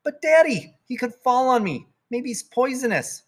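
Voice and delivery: put-on high, whiny little girl begging; pleading child. Girl begging dad to kill the spider, whiny and high. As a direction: beg daddy not to go back to sleep.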